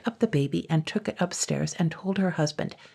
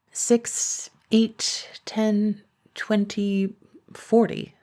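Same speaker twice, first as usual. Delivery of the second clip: counting aloud; 5 semitones higher, much slower than usual. Acted. Blacksmith counting rivets aloud.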